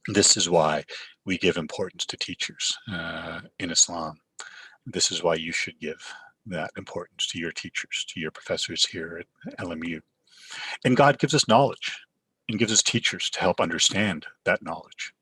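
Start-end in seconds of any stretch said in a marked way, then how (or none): none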